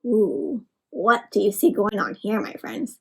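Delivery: ordinary talk, nothing marked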